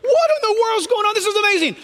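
high, astonished exclamation; wide-eyed, high-pitched wonder; acted amazement. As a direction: marvel as if just arrived in heaven. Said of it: "You're going".

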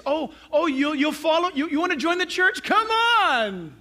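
high, gushing recruiter voice; overeager welcome. Acted. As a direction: wave them in with mock eagerness. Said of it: "we would be tempted like this".

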